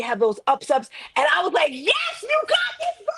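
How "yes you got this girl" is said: high-pitched squeal of self-encouragement; triumphant cheer. Retold or imitated. Coach retelling how well she felt, high jubilant cheer. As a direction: cheer yourself on in a soaring high voice.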